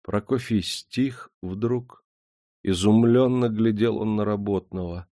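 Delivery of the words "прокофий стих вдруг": usual speaking voice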